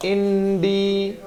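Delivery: Indie- stretched out slowly and softly; very slow model. Shown as a demonstration. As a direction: draw out indie- syllable by syllable. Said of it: "India नहीं होता".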